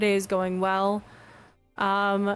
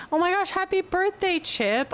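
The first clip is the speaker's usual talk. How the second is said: high, gushing birthday wish; excited congratulations; 10 semitones higher than usual. Real, not acted. Streamer wishing a viewer happy birthday, excited.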